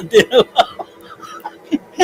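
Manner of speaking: high, loud through laughter; laughing repeat. Real, not acted. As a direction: repeat the word while cracking up. Repeating pun while laughing.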